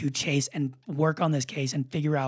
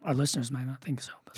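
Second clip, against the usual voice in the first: whispered joking aside; 5 dB quieter than usual. Real, not acted. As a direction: drop to a secret whisper for the joke.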